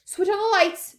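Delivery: high, loud call; shouted order. A narrator's reading. Wonka in the dark tunnel, shouting. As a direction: holler the command. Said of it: "shouted Mister Wonka".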